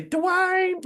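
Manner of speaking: put-on high, drawn-out sitcom girl calling a name; Whitley Gilbert impression. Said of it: "remember how she talked".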